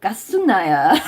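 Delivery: Busan dialect. Shown as a demonstration. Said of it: "in Busan dialect is".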